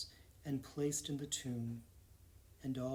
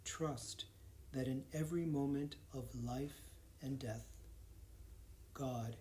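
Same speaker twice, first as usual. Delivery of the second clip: calm guided prayer with long pauses, settling quieter; guided meditation; 4 dB quieter than usual. Real, not acted. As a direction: lead them gently into stillness, phrase by phrase.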